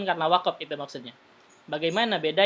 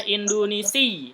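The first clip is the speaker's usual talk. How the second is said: Indonesi slowed, final si pressed and raised; stressed ending; 3 semitones higher, 3 dB louder, slower than usual. Shown as a demonstration. Teacher shows students the pressed ending of Indonesi. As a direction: drag Indonesi out and hit the last si. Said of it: "Tekan ujungnya".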